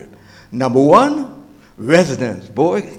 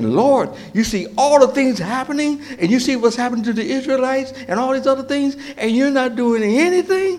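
high, questioning prophet's voice, ends lifting up; voiced complaint; 10 semitones higher than usual. Habakkuk questions the Lord about his inaction, high voiced complaint. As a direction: plead upward in disbelief, imitating the prophet.

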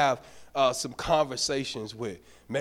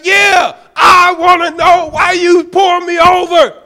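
high, loud threat to film him; angry shout; 14 semitones higher, 15 dB louder than usual. Retold or imitated. Pastor threatening to record the traffic stop, angry shout. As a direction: yell it, spitting mad. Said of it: "I'm spitting, I'm just angry. I'm on ten".

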